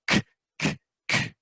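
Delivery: unvoiced "k", repeated; whispered k sound. Shown as a demonstration. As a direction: breathe the "k" sound. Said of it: "I just go".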